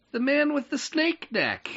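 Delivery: put-on high, small child voice; little girl voice. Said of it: "You're doing voices now".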